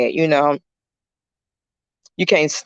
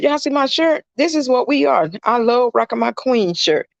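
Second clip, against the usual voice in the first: excited show-off; 9 semitones higher than usual. Real, not acted. Showing off shirt to viewers.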